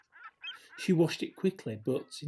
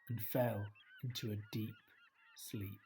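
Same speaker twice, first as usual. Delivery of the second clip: softer and softer, trailing off; fading to sleep; 3 semitones lower, 9 dB quieter than usual. A narrator's reading. Narrator lulling Eliza into deep sleep, fading. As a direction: let the words sink into sleep.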